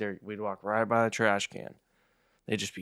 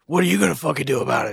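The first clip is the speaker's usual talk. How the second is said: tough-guy quote; 5 semitones higher, 9 dB louder, faster than usual. Retold or imitated. Voicing the hoodlums' comeback.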